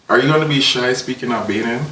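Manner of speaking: normal talking voice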